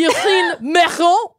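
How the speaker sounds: slow, high, hammed-up French accent. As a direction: overdo it like a cartoon Frenchman. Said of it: "I wanna hear you do it again".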